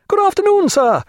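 high, loud society-lady hail; loud greeting. Acted. Lady Forbes suddenly hailing Stephen, loud and high. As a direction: call out a greeting to the visitor.

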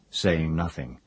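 ordinary delivery, nothing marked

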